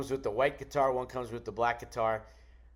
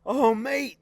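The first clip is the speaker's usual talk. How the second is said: much higher, louder glad greeting; 12 semitones higher, 9 dB louder than usual. Retold or imitated. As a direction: burst out with relief to an old friend. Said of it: "and he's like".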